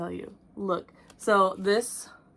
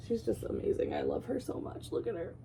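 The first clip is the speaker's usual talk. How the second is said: soft whisper; 8 dB quieter than usual. Real, not acted. Gushing over finished piece.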